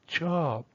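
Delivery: "chop" alone, high then falling; isolated falling tone. Shown as a demonstration. Teacher shows traditional pronunciation, "chop" isolated and falling. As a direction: drop "chop" from high, standing by itself. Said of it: "they're isolated".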